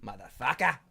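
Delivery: normal talking voice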